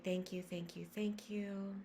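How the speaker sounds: very soft, fading almost to a whisper; hushed gratitude. Real, not acted. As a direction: close the meditation in a reverent hush. Closing the meditation with thanks.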